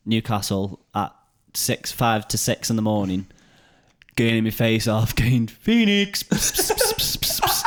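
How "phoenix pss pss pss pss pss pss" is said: very high coaxing cat call with pss sounds; laughing while calling the cat, high and drawn out. Retold or imitated. Searching Newcastle for the lost cat, high coaxing call.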